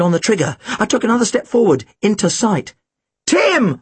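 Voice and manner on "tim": urgent high-pitched call; shouted warning. A narrator's reading. Narrator warning Tim of the gun, shouting. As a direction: call out the name in alarm. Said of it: "I shouted".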